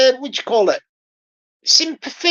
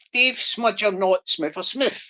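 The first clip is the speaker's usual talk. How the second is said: quicker run at the tongue twister; sped up; 4 semitones lower, 3 dB quieter than usual. Shown as a demonstration. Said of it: "I'll speed it up".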